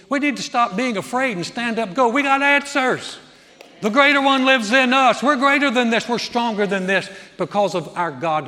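high, loud rallying cry; full-force preaching. Real, not acted. Preacher urging believers to stand up, preaching at full force. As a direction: belt it out like a revival preacher.

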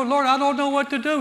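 a touch of helpless prayer; imitating a lost believer. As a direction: plead it to God. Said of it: "If we come to him and go".

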